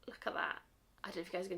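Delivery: breathy awed whisper, close to the mic; hushed whisper. Real, not acted. Reviewer marvelling at shower gel glitter, whispered. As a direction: whisper it as if the glitter might vanish.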